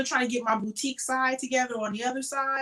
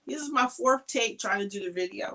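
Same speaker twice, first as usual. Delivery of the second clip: usual speaking voice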